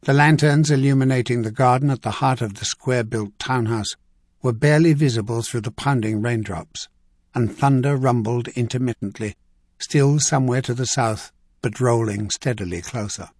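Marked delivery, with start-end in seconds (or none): none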